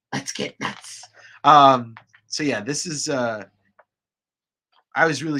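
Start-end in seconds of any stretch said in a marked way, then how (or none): 0.1-1.0 very low whispered threat; a husky vigilante growl-whisper; low raspy hero whisper; Batman impression. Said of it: "Lamar, you wanted some music".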